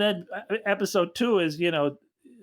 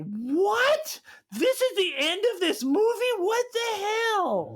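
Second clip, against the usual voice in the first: high, shouted disbelief with a laugh; baffled outrage; 13 semitones higher, slower than usual. Retold or imitated. Baffled at the ending, a high shouted what. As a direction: shout it like the movie just cheated you. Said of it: "I was just like".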